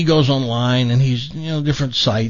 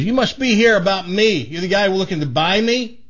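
lightly voiced talking dog, higher; a friendly dog greeting the buyer, voiced a bit higher; 8 semitones higher than usual.

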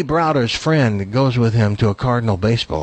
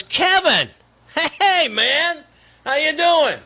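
much higher, bright eager hello; excited greeting; 13 semitones higher, slower than usual. Retold or imitated. Buddy greeting Kevin Spacey, much higher excited hello. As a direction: greet him like an old buddy, up high. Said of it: "And he goes".

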